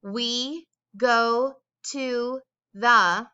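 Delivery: very slow, each word set apart; slow emphasis. Shown as a demonstration. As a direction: point out each popcorn word slowly. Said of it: "are all popcorn words".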